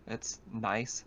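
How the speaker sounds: ordinary talk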